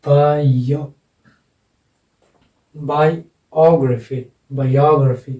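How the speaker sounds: "Biography" broken slowly as bai... OG-ruh-fee, then joined; stress on YO. Shown as a demonstration. Teacher breaks the word up for students, stressed middle syllable. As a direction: draw out and punch "यो" in "biography". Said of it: "तो ये accent लगेगा यहाँ पर".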